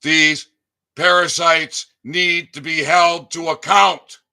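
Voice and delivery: high, loud furious yelling; furious shout. Real, not acted. Ranting to listeners about parasites, furious high shout. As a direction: hurl it at them at full volume. Railing at agencies, then the listener.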